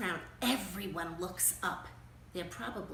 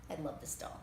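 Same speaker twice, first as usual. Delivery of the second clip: usual speaking voice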